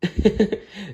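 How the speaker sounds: high fairy giggle; fairy catching herself almost telling the answer, giggling. Acted. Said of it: "Merryweather giggled".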